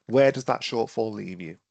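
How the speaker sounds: ordinary delivery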